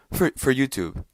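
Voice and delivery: falling, flat answer on YouTube; resigned statement. Retold or imitated. Answering his own question, resigned falling tone.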